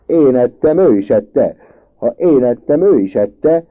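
loud, high, insistent retort; heated protest. A narrator's reading. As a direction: shout the blame onto the other one. Boys quarrel over who ate it.

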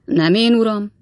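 a touch of stubborn refusal; digging in, lightly. A narrator's reading. Servant refusing his master's hint, mildly stubborn. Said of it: "felelte Weller úr konokul".

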